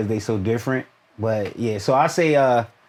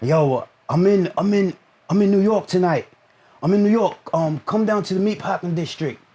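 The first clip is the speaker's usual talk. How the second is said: imitating a hyped friend; 5 semitones higher than usual. Imitating friend's phone invitation.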